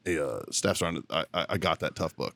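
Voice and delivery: mock-sheepish, low and soft; hesitant, hushed owning up, imitated; sheepish confession. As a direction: play the caught junior, reluctantly confessing. Retold reluctant confession to sergeant.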